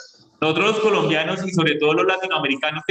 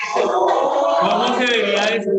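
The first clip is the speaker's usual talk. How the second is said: emphatic rhetorical question; 5 semitones higher, 3 dB louder, slower than usual. Real, not acted. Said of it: "rhetorical challenge to class".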